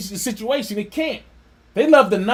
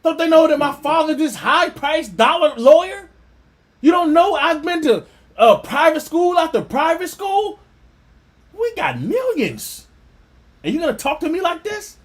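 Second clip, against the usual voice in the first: put-on higher, whiny spoiled rich kid; mock the pampered boy's outrage; mocking the son; 6 semitones higher than usual. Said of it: "he's asking himself that".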